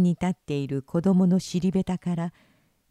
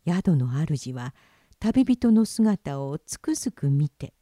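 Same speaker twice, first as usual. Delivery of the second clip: usual speaking voice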